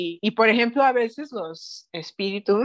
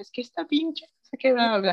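crude uncle; 3 semitones higher than usual. Retold or imitated. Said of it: "literalmente dije".

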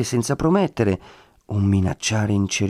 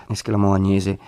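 plain reading voice

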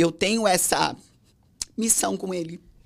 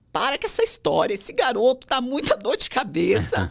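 higher, lilting mock complaint; playful mock protest; 6 semitones higher than usual. Daughter-in-law jokingly refuses her sick mother-in-law's plea, playful protest. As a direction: brush it off with exaggerated exasperation. Brincando com a sogra doente.

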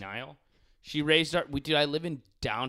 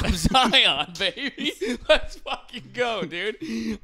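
high, loud laughing brag; laughing hype; 10 semitones higher, 8 dB louder, much slower than usual. Pretend. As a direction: cheer yourself on. Hyping himself as Zion.

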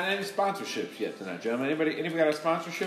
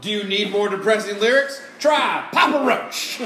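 ad announcer; 7 semitones higher, 8 dB louder than usual. Pretend. Mock radio ad.

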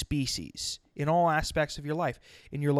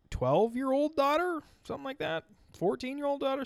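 high questioning; 11 semitones higher than usual. Real, not acted. Unsure questioning age.

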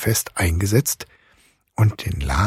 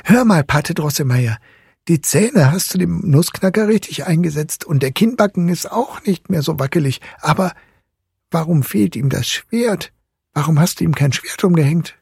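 put-on high, eager boy's voice; interrupting boy; 9 semitones higher than usual. A narrator's reading. Fritz asks about the missing sword, high and pressing. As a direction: question him in a high young voice. Boy character questions godfather.

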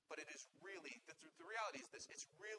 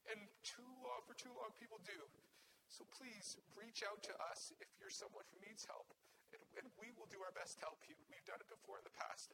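crying; 6 semitones higher, 3 dB quieter than usual. Real, not acted. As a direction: voice raised and strained with tears. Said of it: "I'm crying 'cause".

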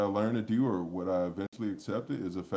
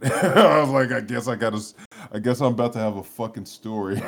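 loud laugh running into the words; 4 semitones higher, 9 dB louder than usual. Real, not acted. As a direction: tell it through real laughter, louder. Laughs telling his own story.

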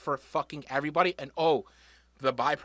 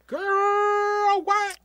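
put-on high squealing "girl, what"; sassy shock; 5 dB louder than usual. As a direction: react like a shocked diva.

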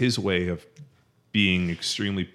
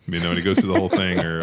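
ordinary delivery

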